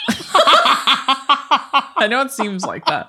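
slow, laughing through the words; half-laughing reassurance. Real, not acted. Laughing apology.

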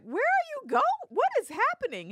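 high, loud mock panic; hammed-up bewildered parent, pitch shooting up; retold alarm. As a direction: squeal it like a baffled mother. Retells alarm as daughter walks off.

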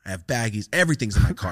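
usual speaking voice

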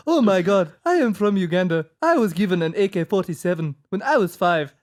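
teasing, put-on African accent; mock African accent. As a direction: imitate the cohost's African accent. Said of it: "That's what you sound like".

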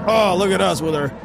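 hammed-up mock rally cry; mock protester rallying. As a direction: imitate an activist urging everyone on. Mimicking protesters rallying round car.

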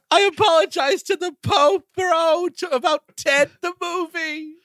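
high-pitched, pleading bro impression; mock apology pushed up high; Mark Wahlberg. Comic as the actor apologizing to the Pope, whiny Wahlberg. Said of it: "Mark Wahlberg apologized to Pope Francis".

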